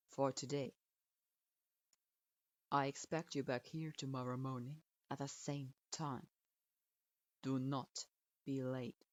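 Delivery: put-on low, curt old teacher grading a student; a grudging low verdict; stern sensei voice. A narrator's reading. Strict sensei grudgingly approving his student, low and curt.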